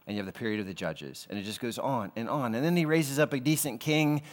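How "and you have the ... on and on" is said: ordinary talk, nothing marked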